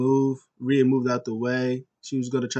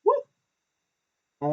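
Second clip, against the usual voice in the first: high whooping cheer; excited woo. Real, not acted. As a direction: let out a happy woo. Cheering for Shanti.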